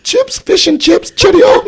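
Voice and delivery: very high, loud, hammed-up fake Cockney; bad Cockney accent. Shown as a demonstration. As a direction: squawk out a terrible Cockney-Jamaican accent. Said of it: "like Cockney mixed in with, like, fake Jamaican".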